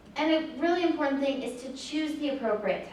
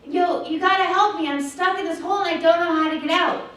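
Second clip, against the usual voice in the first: higher, louder urgent call; yelling to a friend; 5 semitones higher, 6 dB louder than usual. Retold or imitated. Boy in the hole yells up to his friend, loud and urgent. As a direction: shout up to your buddy for help. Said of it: "looks up at his friend and yells".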